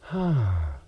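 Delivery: small sigh of relief; worm sigh of relief. A narrator's reading. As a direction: sigh out, safe at last. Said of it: "heaved a little worm sigh of relief".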